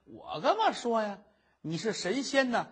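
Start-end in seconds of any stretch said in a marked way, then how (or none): none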